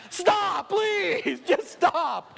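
very high, strained begging; hammed-up desperate plea to stop; exasperated pleading. Preacher begs bored girl to stop rattling bracelets, exasperated.

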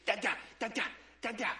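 imitating an excited baby's babble; short bursts of mock baby babble. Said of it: "She's so excited, and she goes".

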